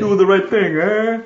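loud, high, hammed-up New Yorker; New York accent. Shown as a demonstration. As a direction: shout it like a cartoon New Yorker. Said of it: "you do a New York ac-, accent".

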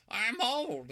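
put-on high, loud old-lady caricature; exaggerated elderly character voice; mock old woman. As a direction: cry it out as a cartoonish old lady. Imitating old woman from the film.

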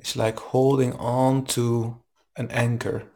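calm, even meditation guide voice; guided meditation. Acted. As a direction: guide them gently into the breath. Leads a guided breathing meditation.

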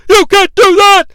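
high, loud outraged shout, stress on You; Vince impression yell. Announcer protesting to Vader, loud outraged yell. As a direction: shout it at the attacker. Vince yelling at ringside chaos.

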